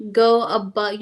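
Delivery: usual speaking voice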